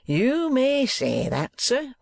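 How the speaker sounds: high, slow, drawn-out schoolmaster voice; Squeers, self-satisfied. A narrator's reading. Schoolmaster agreeing with the impressed gentleman, self-satisfied. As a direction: stretch the words out high and smugly.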